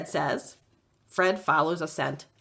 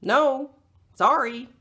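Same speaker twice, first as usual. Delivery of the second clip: louder call from a distance; a fisherman calling back from his boat; 6 semitones higher, 4 dB louder, slower than usual. Acted. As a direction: call back across the lake. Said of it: "the fisherman calls back".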